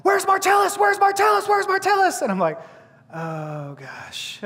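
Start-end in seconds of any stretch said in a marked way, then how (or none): 0.0-2.2 high mock shouts of frantic people; imitated yelling. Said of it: "people yelling, going like". 3.1-4.4 low, soft mock dismay; sinking dread. Storyteller's sinking dread on hearing commotion.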